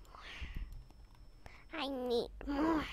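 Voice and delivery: hushed, playful craving; quiet craving. Pretend. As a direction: murmur it hungrily under your breath. Begins building demand for more.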